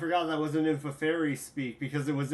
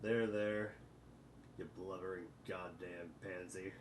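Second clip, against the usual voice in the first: quiet mocking voice; 8 semitones lower, 18 dB quieter than usual. Gamzee-style mocking consoling.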